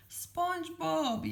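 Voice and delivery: drawn-out, high-pitched yell; acted calling out, slow and high; Mister Krabs. A narrator's reading. Mister Krabs caught in a net, yelling. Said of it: "yelled Mister Krabs".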